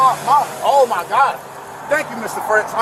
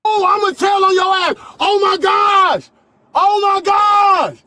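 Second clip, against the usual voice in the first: loud, high furious yelling; berserk rage; 7 semitones higher, 3 dB louder, slower than usual. Real, not acted. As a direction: yell it out in a rage, slowly. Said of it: "he seems to be yelling just fine".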